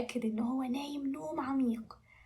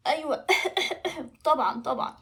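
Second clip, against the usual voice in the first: coughing dragon; 2 semitones higher, 4 dB louder than usual. Acted. Dragon agrees to breathe fire again, coughing. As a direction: agree between throat-clearings.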